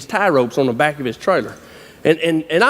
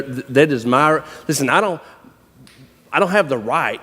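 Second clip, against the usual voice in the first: ordinary talk, nothing marked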